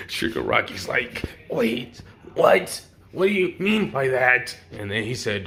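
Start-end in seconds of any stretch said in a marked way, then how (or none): 1.5-4.6 higher, worried put-on voice; alarmed villain. Storyteller as villain threatened by hero, higher mock alarm. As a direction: react in mock alarm, voice rising.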